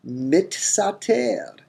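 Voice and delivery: mitsater said slowly and high, mit then sater; careful mitsater. Shown as a demonstration. Teacher repeating the expected form slowly, mitsater. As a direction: drag out mitsater syllable by syllable. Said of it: "we expect a form mitsater".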